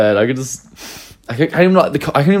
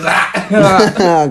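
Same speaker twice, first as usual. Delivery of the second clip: mock cry out; 8 semitones higher, 4 dB louder than usual. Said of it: "Jackson goes".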